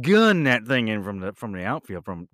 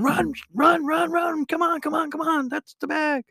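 urgent cheering yell, much higher; yelling encouragement. Retold or imitated. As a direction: shout him all the way home. Coach yelling kid home.

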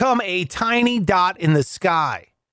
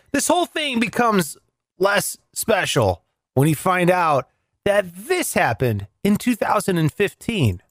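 normal talking voice

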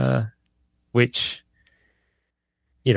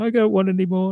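put-on light high kid voice; father imitating his son; child voice; 9 semitones higher than usual. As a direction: mimic the son refusing. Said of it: "he's like".

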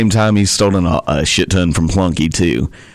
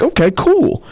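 mock breezy okay. Sarcastic reaction to cancelled show.

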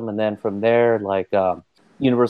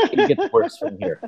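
spooky warning; 10 semitones higher than usual. Retold or imitated. Voicing EMT's warning.

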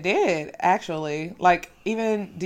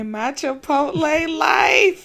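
slow, high, shouted echo; mock outrage; 7 semitones higher, slower than usual. Real, not acted. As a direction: echo it in disbelief. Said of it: "[name] echoes with mock outrage".

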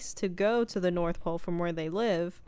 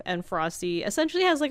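ordinary delivery

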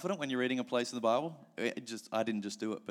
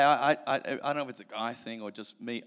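ordinary talk, nothing marked

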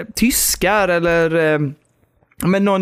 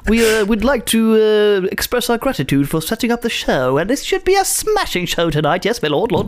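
hammed-up RP accent; mock posh British; 4 semitones higher than usual. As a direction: parody a snooty band thanking the crowd. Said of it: "sån jävla uppröv engelska".

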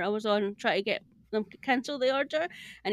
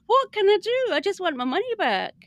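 much higher, louder plea; frustrated outburst; 10 semitones higher, 6 dB louder than usual. Retold or imitated. Customer begging support agent for a refund, loud and high. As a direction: let the frustration burst out high.

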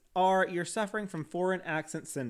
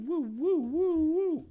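high rising ghostly woo-woo, much higher and louder; spooky woo; 12 semitones higher, 3 dB louder, slower than usual. Pretend. Host hamming up the creepy case, with ghostly woos. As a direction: moan woo like a cartoon ghost.

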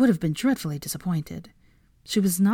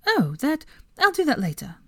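distracted; 8 semitones higher than usual. A narrator's reading. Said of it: "said Hermione distractedly".

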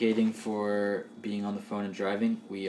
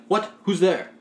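startled guard; 10 semitones higher, 7 dB louder than usual. Acted. Startled game guard voiced.

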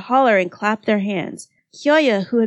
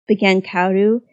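plain reading voice

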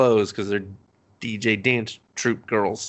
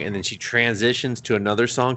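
normal talking voice

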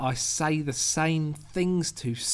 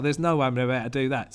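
rushed; much faster than usual. Retold or imitated. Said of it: "I was like".

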